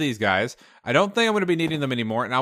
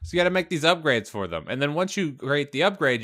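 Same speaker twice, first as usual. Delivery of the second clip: ordinary delivery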